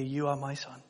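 soft, hushed, near-whisper of love; whispered fatherly blessing. Acted. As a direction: whisper it like a father encouraging his son. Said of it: "to hear".

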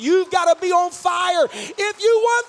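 shouted altar call; rousing shout. Real, not acted. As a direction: fire the congregation up. Rousing congregation to stand.

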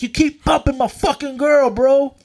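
high, heated imitation of an aggrieved guy; angry man confronting. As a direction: accuse him like you're squaring up. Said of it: "this angry man's face".

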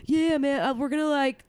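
high, laid-back surfer bro voice, pitched well up; surfer dude impression. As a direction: ham up a mellow California stoner. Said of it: "I'm really doing a terrible job".

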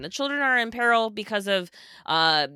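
high-pitched parody of moral panic; mock alarm. Mocking panic over candy-flavored cigarettes, mock alarm. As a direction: chant the scare slogans with feigned urgency.

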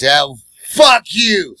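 high, loud "fuck you"; drunk snarl. Retold or imitated. Drunk passenger snarling at the driver. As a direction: spit it out.

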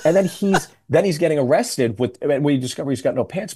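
ordinary talk, nothing marked

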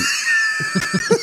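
a high shrieking laugh bursting out. Real, not acted. Friend laughing at his own crude comparison, shrieking laugh. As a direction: crack up at your own joke.

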